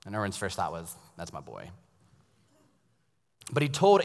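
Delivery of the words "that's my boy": hushed aside. Retold or imitated. Father's private proud thought.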